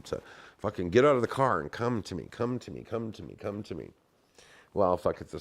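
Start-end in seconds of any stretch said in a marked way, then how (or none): 1.6-3.9 softer and softer, a fading plea; urging come to me. Retold or imitated. Willing friends in the car to come, fading murmur. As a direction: coax them under your breath, fading out.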